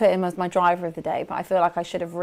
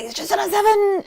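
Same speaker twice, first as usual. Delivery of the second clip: imitating an excited call; 13 semitones higher than usual. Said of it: "I love how you were like".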